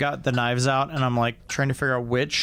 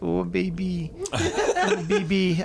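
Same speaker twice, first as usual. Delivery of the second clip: put-on breathy purring Russian accent, teasing; mock Russian cam girl coo; 6 semitones higher than usual. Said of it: "Russian, uh, cam girl voice".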